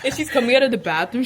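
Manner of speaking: normal talking voice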